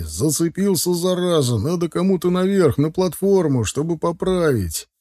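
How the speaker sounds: mildly acted urgent shout; calling out. A narrator's reading. Sergei tells them someone must climb up, mild shout. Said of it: "крикнул Сергей".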